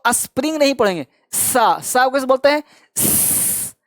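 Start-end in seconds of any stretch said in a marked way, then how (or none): 3.0-3.7 a long whistled s with no voice; s said as a sharp whistle; hissing s. Shown as a demonstration. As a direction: hiss the s like a whistle. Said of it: "city मारना".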